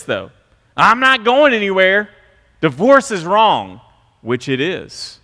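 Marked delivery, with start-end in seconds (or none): 0.8-3.8 louder, higher firm vow; religious spouse. Retold or imitated. Religious spouse insisting on no divorce, loud and firm. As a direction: declare it like a point of pride. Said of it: "make statements like this".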